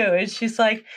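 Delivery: normal talking voice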